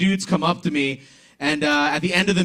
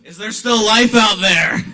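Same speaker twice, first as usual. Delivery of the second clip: loud, slow shout to the back of the room; booming call into the room; 3 semitones higher, 8 dB louder, slower than usual. Real, not acted. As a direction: wake up a dead crowd. Comic calls out to quiet crowd.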